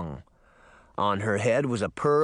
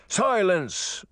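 higher-pitched, sharp command; guard's shout; 7 semitones higher than usual. A narrator's reading. Merman guard silences Uncle Jake, commanding shout. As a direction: order silence like a royal guard. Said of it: "he shouted".

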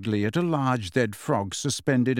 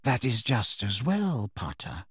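cold; 5 dB quieter than usual. A narrator's reading. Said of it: "said Snape coldly".

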